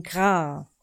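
kurzes, tiefes Krächzen; krächze wie ein Rabe; Rabenkrächzen. A narrator's reading. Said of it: "berichtete Meister Schwarzrock". (krächzendes gra)

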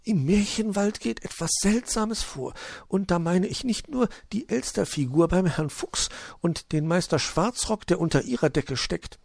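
high magpie voice, conspiratorial; gossiping magpie. Acted. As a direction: share a suspicion in secret. Magpie confides suspicions.

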